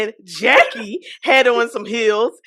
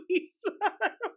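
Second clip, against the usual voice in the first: high, faint laugh-talk; helpless laughter; 6 semitones higher, 14 dB quieter than usual. Real, not acted. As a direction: squeak the words out while laughing. Cracking up while telling the story.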